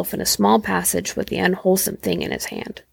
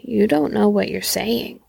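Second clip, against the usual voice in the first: plain reading voice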